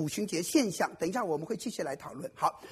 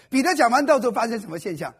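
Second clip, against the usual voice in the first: 彼得讲完道之后 asked again much louder to rouse the room; louder repeat; 2 semitones higher, 10 dB louder than usual. Shown as a demonstration. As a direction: push the same question out louder, demanding an answer.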